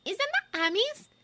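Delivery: put-on high, slow girl voice in wonder; drawn-out amazed girl voice. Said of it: "sounding amazed".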